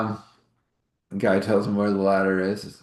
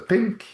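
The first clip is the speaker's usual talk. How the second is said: high bright ping, said like a chime; ping sound; 14 semitones higher than usual. Acted. Narrator voicing the dish as the internet connects, high ping. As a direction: make the connection noise.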